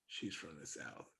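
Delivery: very soft, low aside; under the breath. Retold or imitated. Spotting a countrywoman on a castle tour, under his breath. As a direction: drop it right down, as if to yourself. Retold private thought on overhearing.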